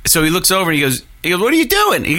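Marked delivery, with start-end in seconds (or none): 1.4-2.0 high, sharp, startled rebuke; mock scolding. Plumber catching his trainee's blunder, mock scolding. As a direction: bark it up high like a boss catching a mistake.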